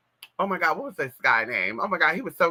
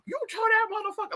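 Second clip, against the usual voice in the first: much higher put-on yell that drops in volume; man voice yelling; 4 dB quieter, faster than usual. Mama on the phone still in her man voice. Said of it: "with that man voice and say".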